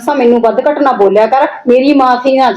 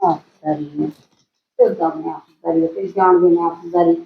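lower, softer sarcastic parroting; mocking echo; 8 semitones lower, 7 dB quieter than usual. Wife mocks her husband by repeating his excuse, sneering. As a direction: throw his own word back at him with scorn.

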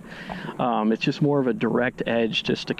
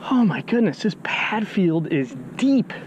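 amazed; 6 semitones higher than usual. Real, not acted. Amazed the pads are deep.

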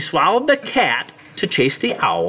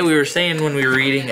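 ordinary delivery, nothing marked

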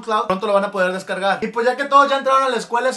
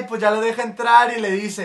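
ordinary talk, nothing marked

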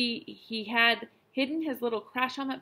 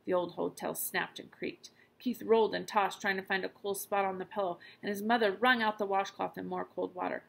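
ordinary delivery

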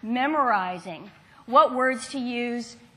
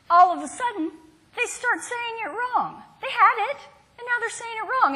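much higher, wide-eyed surprise; mock dismay; 10 semitones higher than usual. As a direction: sell the twist that they get it wrong. Surprise at children's sudden errors.

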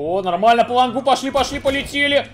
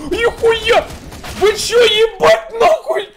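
high startled outburst; shocked shout; 12 semitones higher, 8 dB louder, much slower than usual. Real, not acted. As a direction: yell in disbelief. Shocked by enemy trick. (потрясённый крик)

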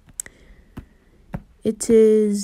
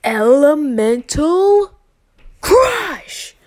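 slow, then a high shouted "Crush!"; drawn-out battle cry that peaks up high; attack call; 7 semitones higher, 6 dB louder, much slower than usual. Retold or imitated. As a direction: yell out the finishing move. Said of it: "He calls out".

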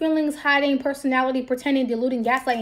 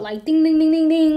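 mock alarm bell; 3 semitones higher, 6 dB louder than usual. Imitating an alarm going off.

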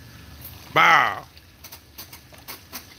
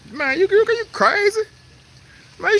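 surprised. Real, not acted. Disbelief at rival's gators.

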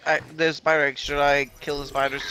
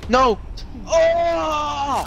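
dismayed outburst; 9 semitones higher than usual. Real, not acted. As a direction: shout it as the fight goes wrong. Teammate downed, match lost.

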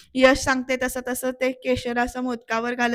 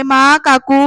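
loud call for mother and aunt; 9 dB louder, much slower than usual. Retold or imitated. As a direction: shout it across the room, louder. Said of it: "यामी परत हाका मारते". (पुन्हा मोठ्याने हाका मारणे)

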